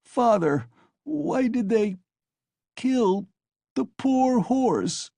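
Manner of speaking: put-on very high, slow child's sobbing question. A narrator's reading. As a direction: plead through sobs. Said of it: "he sobbed".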